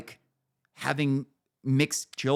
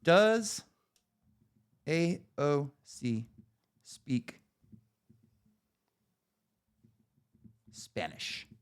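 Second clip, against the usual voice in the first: slow typed-out reading; 4 dB quieter, much slower than usual. Real, not acted. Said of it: "Let's see".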